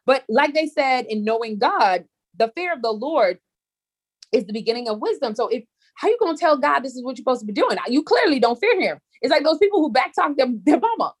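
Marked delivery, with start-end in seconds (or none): none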